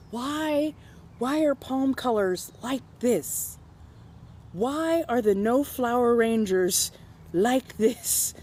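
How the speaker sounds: high, slow exasperated questioning; exasperated why. Retold or imitated. Her brain reacting to customers, exasperated and high. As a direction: plead to the universe, baffled. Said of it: "part of my brain just goes".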